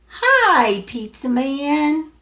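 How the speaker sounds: high, bright call to the pizza man; cheerful greeting. Acted. Reading to a toddler, calling out to the pizza man, cheerful. Reading the book's refrain aloud.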